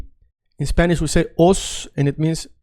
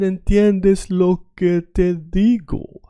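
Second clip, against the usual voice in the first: slowly, each word drawn out for a beginner; slow learner version; 4 semitones higher, much slower than usual. Shown as a demonstration. Teacher to students, slow careful Spanish pronunciation. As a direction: spell it out slowly like a learner hears it. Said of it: "I'm saying it very fast".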